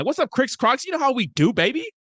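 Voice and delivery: big hype welcome for your homie; hyped shout-out. Real, not acted. Streamer hyping up a chatter, high excited greeting.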